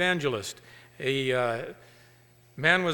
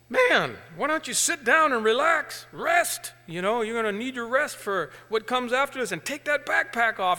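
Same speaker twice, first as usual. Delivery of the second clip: coaxing farmer; 7 semitones higher, 5 dB louder than usual. Acted. Farmer urges hitchhiker to rest.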